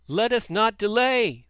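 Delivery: loud, high urgent shout; shouting crowd. A narrator's reading. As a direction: rouse the crowd to move now. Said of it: "they shouted".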